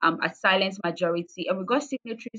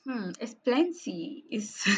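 amused, overwhelmed; 3 semitones higher, slower than usual. Real, not acted. Daunted laugh at a big question.

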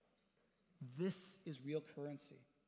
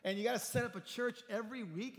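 teasing, higher incredulous buddy voice; kidding friend; 6 semitones higher, 4 dB louder than usual. Retold or imitated. Friend teasing the pastor about carpet squares, incredulous. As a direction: rib him like a mega-church friend. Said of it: "So kiddingly".

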